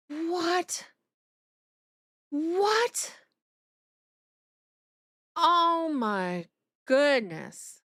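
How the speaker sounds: high, stunned disbelief; stunned what. Real, not acted. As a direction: repeat it in pure shock. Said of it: "my mouth went a little crazy".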